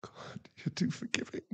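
breathless whispered disbelief. Acted. To his forgiving partner, a whisper. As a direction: barely breathe the words out.

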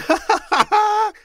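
loud, high peals of laughter; hearty, unrestrained ha-ha; splendid big laugh. A narrator's reading. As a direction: laugh out in triumph. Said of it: "it was a splendid laugh".

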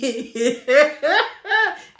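mocking cackle at a rival; gloating laugh. Real, not acted. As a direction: laugh out at her in triumph.

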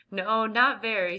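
usual speaking voice

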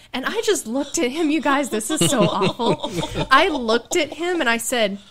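high, loud, laughing retelling. Real, not acted. Telling the hosts about her husband's badly timed proposal, laughing. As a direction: laugh through the embarrassing story.

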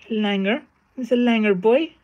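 high, sing-song imitation of Cork accent; mock Cork accent. Streamer mimics Cork lads calling someone langer, high Cork accent. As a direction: pitch way up, like a Cork lad. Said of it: "they always speak higher".

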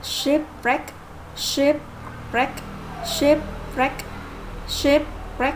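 teacher modelling "shipwreck" stressed on SHIP; stress first syllable. Shown as a demonstration. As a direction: lift and lean on SHIP in "shipwreck". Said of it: "nhấn âm thứ nhất".

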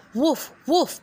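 high yappy woof; dog bark. Acted. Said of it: "barked the dog".

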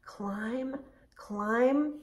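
slow, breathy climbing whisper. Shown as a demonstration. Teacher whispering the climb along with the kids. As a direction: whisper it as if creeping up a tree. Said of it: "They like to be up in the trees".

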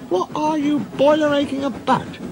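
very high-pitched scornful character voice; scornful duck engine. A narrator's reading. Engine asks grumblers what they moan about, scornful. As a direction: challenge them in a high, put-on voice.

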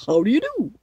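loud, put-on scarecrow greeting; husky scarecrow. A narrator's reading. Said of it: "said the scarecrow in a rather husky voice".

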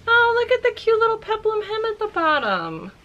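high, delighted gush. Real, not acted. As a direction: coo over something adorable. Gushing over a dress.

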